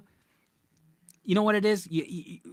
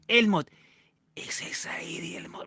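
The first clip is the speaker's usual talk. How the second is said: demon mom; 5 semitones higher than usual. Retold or imitated.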